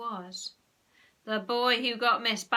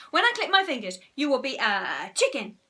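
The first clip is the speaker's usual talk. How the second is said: loud, high instruction; commanding hypnotist; 5 semitones higher, 8 dB louder than usual. A narrator's reading. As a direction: announce the spell with relish. Henry commands hypnotised subject.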